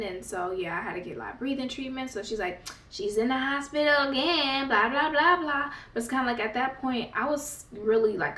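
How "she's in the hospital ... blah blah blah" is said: higher, sing-song blah blah mocking; mock mom brushing it off; dismissive mockery.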